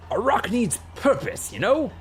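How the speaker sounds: high, lilting, big god voice; puffed-up, high-pitched musing; expansive god. A narrator's reading. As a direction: pronounce it with grand, grudging approval. God speaks expansively of the town.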